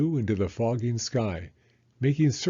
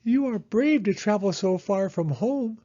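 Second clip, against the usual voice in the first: high, raised call up the tunnel; 11 semitones higher, 4 dB louder than usual. Acted. Hedgehog calling up the tunnel to Mole, raised and high. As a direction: call up to her friend ahead. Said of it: "Hedgehog shouted up to Mole".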